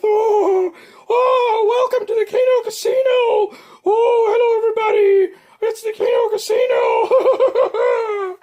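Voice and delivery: very high, loud shouted caricature with a crying edge; P P P impression. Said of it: "Just needs more wheezing and, and running out of breath".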